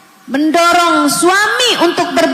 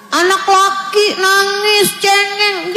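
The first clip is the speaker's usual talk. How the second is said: mocking scold; 4 semitones higher, slower than usual. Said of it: "Jangan gitu, Bu".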